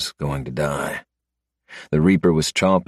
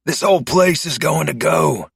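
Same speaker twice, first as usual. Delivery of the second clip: coughing; 11 semitones higher than usual. A narrator's reading. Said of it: "he said, coughing".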